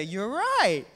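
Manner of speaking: high, loud, puffed-up reply; cocky agreement. Acted. Peter proudly accepting Jesus' praise, cocky. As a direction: crow it like you've earned it. Peter boasting, taking title on.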